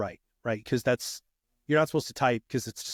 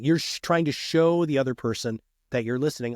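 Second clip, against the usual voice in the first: usual speaking voice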